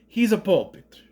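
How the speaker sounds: "puppet" in a put-on Italian accent; mock Italian accent. Geppetto imagined explaining his son to the school, mock Italian accent. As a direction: say he's a puppet like a cartoon Italian. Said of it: "is it my Italian accent".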